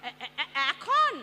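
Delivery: slow, loud stuttering with alarm; stammering loudly, caught off guard by the caller; panicked stammer. Acted. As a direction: trip over the word in sheer panic. Panicked stammer on phone call.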